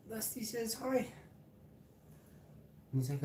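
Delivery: high cooing pet voice. Real, not acted. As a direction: coo at the pet, high and sweet. Talking to a pet.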